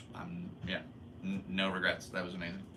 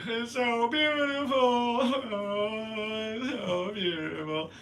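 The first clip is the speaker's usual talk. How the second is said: high, laughing-crying gush over beauty; overwhelmed tearful awe, half laughing; higher, louder mock sob of wonder; retold crying; 14 semitones higher, 6 dB louder, slower than usual.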